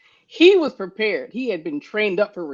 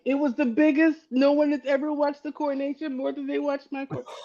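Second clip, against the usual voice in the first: lightly voiced boastful Trump, laughing through it; Donald Trump impression; 6 semitones higher than usual. Joking about the funeral ratings, a light laughing Trump imitation. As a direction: brag about the crowd like a mocking Trump. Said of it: "some Donald Trump".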